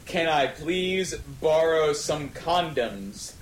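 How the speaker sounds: ordinary delivery, nothing marked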